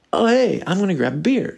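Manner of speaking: high, breezy mock greeting; fake friendliness. Greeting a disliked acquaintance when they show up, fake friendly. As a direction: imitate an over-casual hello that drops toward normal. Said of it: "so impersonal and so weird and so fake".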